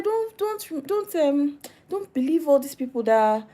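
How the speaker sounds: high-pitched pleading caution; imitated urgent plea, played for comedy; mock warning. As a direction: act a fussy warning, starting very high. Acts people warning her off others.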